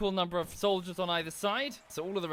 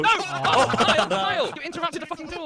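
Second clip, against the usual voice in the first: outraged shout; 12 semitones higher, 9 dB louder than usual. Real, not acted. Teammate spoils the duel.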